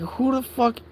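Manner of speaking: a high, baffled mock exclamation; incredulous outburst. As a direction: blurt it in total disbelief. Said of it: "And I'm just like".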